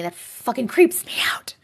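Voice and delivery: hushed creeped-out whisper. Real, not acted. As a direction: breathe it as an unnerved aside. Whispered aside during story.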